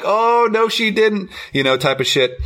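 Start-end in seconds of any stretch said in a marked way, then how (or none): 0.0-1.3 much higher, sassy "oh no she didn't"; sassy onlooker. Retold or imitated. Imitating the crowd cheering the middle finger, sassy high voice. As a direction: play the cheering crowd, all sass. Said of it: "they were like".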